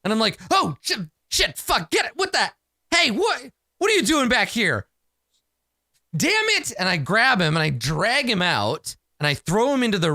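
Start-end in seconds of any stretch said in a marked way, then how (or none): 0.5-6.7 high, flustered acted outburst; startled swearing. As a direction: splutter curses in pitched-up shock. Acted startled outburst after stabbing.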